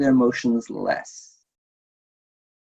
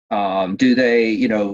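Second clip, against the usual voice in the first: ordinary talk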